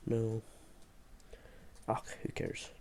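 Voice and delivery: normal talking voice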